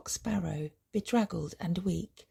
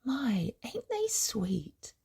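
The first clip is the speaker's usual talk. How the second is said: higher, drawn-out cooing; softly delighted old woman; fond exclamation; 4 semitones higher, 3 dB quieter, slower than usual. A narrator's reading. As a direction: coo slowly over adorable babies.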